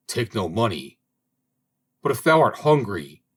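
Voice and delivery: ordinary delivery, nothing marked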